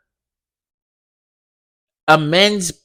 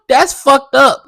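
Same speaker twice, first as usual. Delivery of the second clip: higher, louder, slow laugh; 8 semitones higher, 5 dB louder, slower than usual. Real, not acted. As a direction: laugh it out in disbelief. Laughs at own comment.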